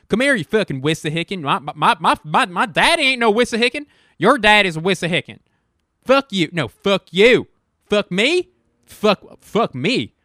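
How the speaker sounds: loud, high, hammed-up cursing tirade; mock furious shouting. Ranting at an imaginary Wissahickon, loud mock fury. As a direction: pick a fake fight at the top of your lungs.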